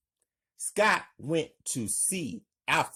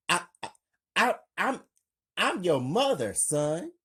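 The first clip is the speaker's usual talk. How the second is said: stammering; 6 semitones higher, 3 dB louder, faster than usual. Acted. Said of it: "smiling and coughing".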